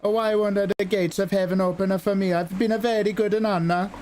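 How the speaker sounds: Italian nun pleading. Acted. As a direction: beg Saint Peter with a light Italian lilt. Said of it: "And she goes".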